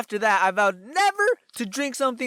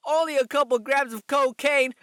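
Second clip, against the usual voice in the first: high, cheeky boast breaking into a laugh; joking fake confession; 6 semitones higher than usual. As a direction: make a mock confession and cackle after it. Joke answer, then laugh.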